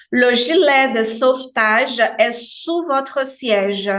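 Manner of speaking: slow careful French "Le gilet de sauvetage est sous votre siège"; teacher slowly models "sous" with a clear U; slow French demo. Shown as a demonstration. Teacher reads a flight safety line, slow French demo. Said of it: "está embaixo do assento".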